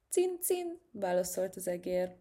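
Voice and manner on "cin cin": squeaky mouse voice, much higher; mouse squeak. Acted. (magas, cincogó egérhang)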